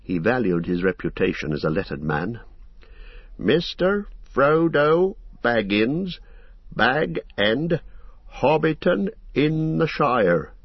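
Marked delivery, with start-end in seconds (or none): 3.4-10.5 slow and proud. Acted. Landlord proudly reading the letter's address, slow. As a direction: read the address out slowly and proudly. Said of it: "reading out the address slowly and proudly".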